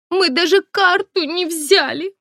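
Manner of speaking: high, wide-eyed coy schoolgirl; wide-eyed girlish voice. A narrator's reading. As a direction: play it innocent and coy. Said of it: "округлила глаза первая". (наивный девичий голосок)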